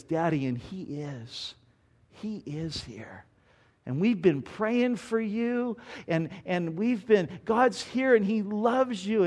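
soft, slow and hushed, a daughter comforting her father; a little girl lovingly telling her father God loves him; tender child's voice. Retold or imitated. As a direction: gently reassure a sick dad. Daughter tells dying father gently.

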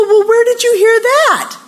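high, loud amazed question; incredulous. Retold or imitated. Mother asking daughter where she heard the saying, incredulous. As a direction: demand an answer in exaggerated disbelief.

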